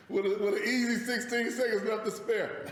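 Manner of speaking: laughing. Real, not acted. Laughing while mocking the time.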